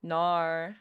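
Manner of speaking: mock Aussie drawn-out "Nar"; Australian accent. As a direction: stretch it like an Australian. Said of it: "forget what Australians even sound like".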